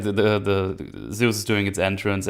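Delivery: ordinary talk, nothing marked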